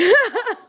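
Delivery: high, loud burst of laughter. Real, not acted.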